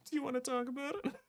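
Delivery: high, soft and quick, with laughter; mock-concerned echo. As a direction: echo it in a tiny squeaky voice. Echoing player's line in jest.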